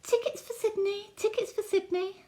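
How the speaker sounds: high, small ticket-seller's call; little voice piping up with an offer. Retold or imitated. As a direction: put on a tiny, high-pitched voice. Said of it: "we had this little, like, voice saying".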